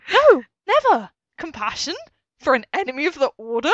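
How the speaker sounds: loud, high, outraged reply; outraged shout. Acted. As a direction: shout it in disbelief. Reading Kylo's outraged lines.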